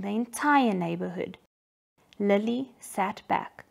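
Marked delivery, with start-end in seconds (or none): none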